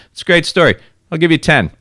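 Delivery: high, loud mock dealer; unimpressed buyer. Buyer lowballing a widow after her story, loud mock offer. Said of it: "And he's like".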